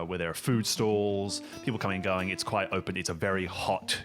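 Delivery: normal talking voice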